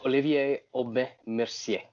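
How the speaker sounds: name said the French Canadian way; quiet French Canadian pronunciation; French Canadian accent. Shown as a demonstration. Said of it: "my terrible French Canadian accent".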